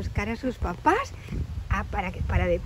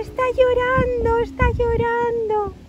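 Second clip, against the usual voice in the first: put-on high, loud childlike squeak; little squirrel Ethan; 12 semitones higher, 6 dB louder than usual. Little squirrel announcing the bear is crying, high urgent squeak. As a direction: report it urgently in a tiny voice.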